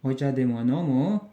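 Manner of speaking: plain-form question, pitch rising on "nomu"; intonasi naik. Shown as a demonstration. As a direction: lift the end of "nomu" to ask. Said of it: "intonasinya naik". (akhir "nomu" bernada naik)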